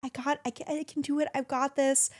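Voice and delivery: a touch of tearful pep talk; choked-up self-talk. Retold or imitated. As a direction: hold back tears while cheering yourself on. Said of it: "I wanted to cry 'cause I'm like".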